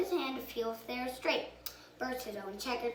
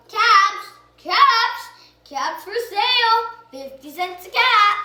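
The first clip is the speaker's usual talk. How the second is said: loud peddler's street cry; calling out wares; 8 semitones higher, 15 dB louder than usual. A narrator's reading. Peddler hawking caps in the street, loud sing-song call. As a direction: sing out to sell caps to passersby. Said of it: "he called".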